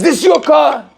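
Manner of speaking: sharp, high accusing question; angry stranger. Retold or imitated. Angry car owner confronts the rabbi, high and sharp. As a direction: confront him about his car.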